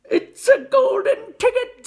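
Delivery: high, loud, ecstatic shriek; excited scream. A narrator's reading. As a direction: scream it with joy. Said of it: "screamed the shopkeeper".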